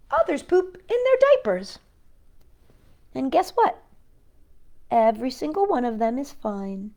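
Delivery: lively read-aloud voice for little listeners; reading to children. A narrator's reading. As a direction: read it like a bedtime picture book. Reading picture book to children.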